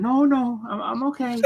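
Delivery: high, tight insisting he's fine; strained okay. Retold or imitated. Steve denying the burn, high strained reply. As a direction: pretend you're fine while burning. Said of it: "He's like".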